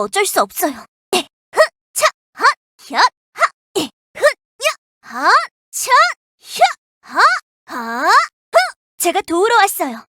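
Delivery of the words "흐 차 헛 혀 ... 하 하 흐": sharp grunts of effort with each swing; short fighting exertion huffs. Acted. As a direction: grunt hard as you strike. Combat effort while fighting.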